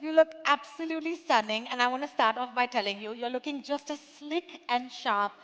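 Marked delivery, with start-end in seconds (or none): 0.0-1.7 loud gushing compliment; louder and higher, gushing over her; flattering. Real, not acted. Host compliments arriving actress.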